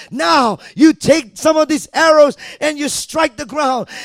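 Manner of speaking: loud commanding shout; voicing the Lord. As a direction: call out God's order. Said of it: "the Lord says".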